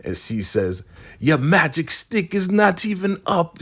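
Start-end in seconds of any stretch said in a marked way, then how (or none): 1.2-3.6 high-pitched, incredulous put-down; comic high-voiced jeer; mocking complaint. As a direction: act out a scornful woman, pitched up. Acted woman mocking the man.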